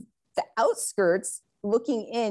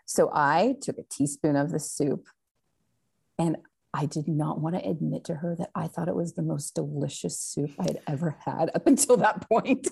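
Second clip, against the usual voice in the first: ordinary talk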